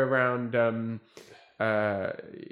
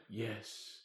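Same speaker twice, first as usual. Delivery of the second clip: theatrical producer; 5 dB quieter, slower than usual. Retold or imitated. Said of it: "I'm gonna give him this voice".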